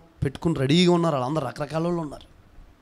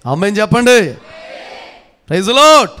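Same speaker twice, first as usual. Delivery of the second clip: high, very loud shout; congregation call; 4 semitones higher, 12 dB louder than usual. Real, not acted. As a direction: demand a response at full voice. Demands amen from congregation. (సంఘం స్పందించేలా బిగ్గరగా కేక)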